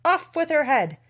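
high, loud royal order; storybook tyrant's yelled command; shouting. A narrator's reading. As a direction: shout the sentence like an outraged queen. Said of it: "the queen shouted at the top of her voice".

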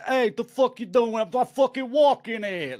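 loud-mouthed mock Cockney, pitched well up; Cockney accent. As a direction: bark it out in a hammed-up Cockney. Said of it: "Cockney is like, they're".

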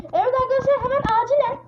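high, loud frantic rush to the viewers; sudden scare, half shouting to the viewers; panicked. Real, not acted. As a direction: blurt it out in real panic. Panicked jumpscare moment.

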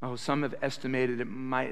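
ordinary talk

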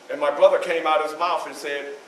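ordinary talk

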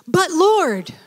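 high, loud protest; high, loud surprised protest; startled objection. Acted. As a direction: balk at the order. Ananias objecting to God.

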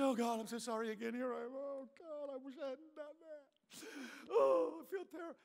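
soft, trailing-off wail of apology; mock-miserable groan to God; grovelling penitent. Believer says sorry to God yet again, mock-miserable. Voicing the remorseful believer praying.

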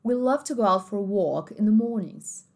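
British English, r dropped in mornings; British accent. Shown as a demonstration. Teacher contrasting accents for students, British take. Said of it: "Britânico".

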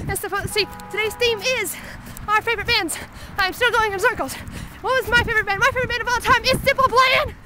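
high, full-volume screamed vlog; breathless high-pitched yelling on the move; screaming. Real, not acted. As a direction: scream it excitedly while running in circles. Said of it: "so I have to scream".

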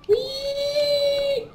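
very high, drawn-out squeal; playful high cry. Pretend. Player falls through the level, playful high cry. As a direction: sing it out high as if flying.

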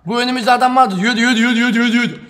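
loud high urgent yelling; shouting. Real, not acted. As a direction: yell at the teammate to run. Urging teammate to run.

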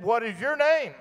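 high-pitched copycat call; mocking echo reply. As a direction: echo the question straight back, high. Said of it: "And he said".

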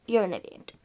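You're an idiot said low and soft under the breath; muttered insult. Acted. Scolding herself quietly, low soft mutter. Says the same insult twice.

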